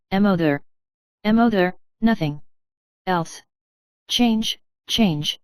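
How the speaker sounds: each syllable of M O there and change spaced out and hit; the doctor repeats the patient's words, stressing every syllable; every syllable stressed. A narrator's reading. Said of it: "stressing every syllable".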